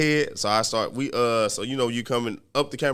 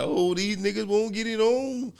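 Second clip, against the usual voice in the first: onlooker's voice; 8 semitones higher, 3 dB quieter, slower than usual. Retold or imitated. Voicing onlookers.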